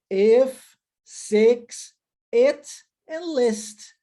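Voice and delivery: devagar, com som de e; e sound list. Shown as a demonstration. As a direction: spell out each e vowel slowly. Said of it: "som de E".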